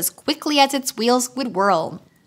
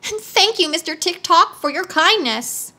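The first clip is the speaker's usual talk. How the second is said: eager wheeler; 7 semitones higher than usual. A narrator's reading. Wheeler eagerly agrees to Tick-Tock, high. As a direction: jump at the offer. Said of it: "replied the wheeler eagerly".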